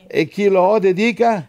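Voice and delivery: declaimed scripture cue; leading a responsive reading. Real, not acted. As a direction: call out the verse for a response. Leads congregation in responsive verse.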